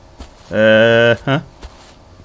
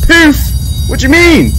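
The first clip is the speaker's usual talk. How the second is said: surprised; 11 semitones higher than usual. Real, not acted. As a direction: squeak the word in disbelief.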